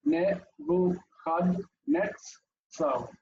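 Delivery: very slow "Nebuchadnezzar", Ne-vu-khad-nets-tsar, a pause after each syllable. Shown as a demonstration. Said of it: "in the following way".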